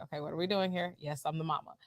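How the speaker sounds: low and very soft; hushed, low-pitched retold answer; retold guarded reply. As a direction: answer quietly, as if to yourself. Retold guarded answers when questioned.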